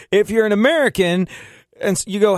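usual speaking voice

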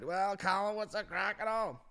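very high-pitched hammed-up spide; smecked-out spide. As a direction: greet him like a wasted street lad. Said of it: "this fucking spide appears at the side, full smecked out".

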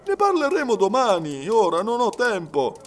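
high-pitched, brisk brush-off. A narrator's reading. Servant to detective, no time now, brushing off.

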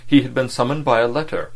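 ordinary delivery, nothing marked

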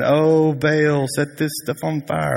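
lightly mocked droning plea to a god; mock prayer. Prophets praying to Baal for fire, in mock prayer. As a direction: pretend to chant a prayer, hammed up. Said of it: "they start praying".

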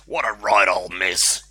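slow, loud disgusted reindeer. Acted. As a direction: spit it out in disgust. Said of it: "he said with disgust".

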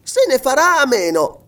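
put-on high, loud boyish outburst; resolute exclamation. A narrator's reading. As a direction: declare it boldly, high and forceful. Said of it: "esclamò il giovinotto".

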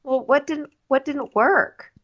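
slow, high, drawn-out what didn't work; drawn-out mock question. Woman asking her ex what went wrong, slow high drawn-out mock. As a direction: stretch it slowly and lift it up. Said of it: "She's like".

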